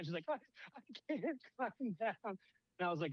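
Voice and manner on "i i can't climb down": high, slow damsel whine; hammed-up frightened protest; mock helpless panic. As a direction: imitate a scared damsel, pitched way up. Voicing panicked Mary Jane.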